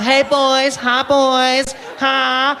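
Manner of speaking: high coy greeting; flirty beach woman.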